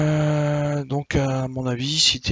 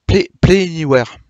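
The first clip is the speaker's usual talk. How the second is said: English words in a heavy French accent, higher and louder; poor English accent; 5 semitones higher, 7 dB louder than usual. Real, not acted. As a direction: attempt an English accent, not very well. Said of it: "avec mon accent anglais". (accent anglais approximatif)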